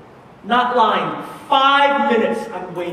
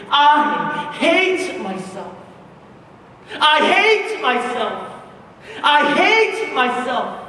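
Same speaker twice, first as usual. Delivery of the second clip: slow, raised-pitch retold self-loathing cry; anguished repeated cry; 5 semitones higher, 3 dB louder, slower than usual. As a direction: stretch HATE out, high and insistent. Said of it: "she kept saying this over and over".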